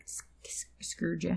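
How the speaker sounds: a secretive little whisper. Pretend. Teasing her partner with a hushed aside, whispered. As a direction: whisper the word, no voice at all. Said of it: "unvoiced whisper of screwed".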